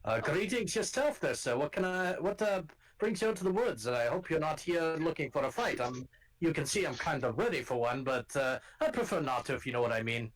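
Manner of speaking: gruff Scottish guard calling out to strangers; rough Scottish accent. Acted. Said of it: "[name] is Irish".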